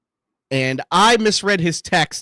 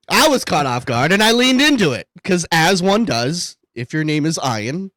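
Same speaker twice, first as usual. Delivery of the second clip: normal talking voice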